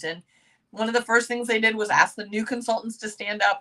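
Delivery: ordinary talk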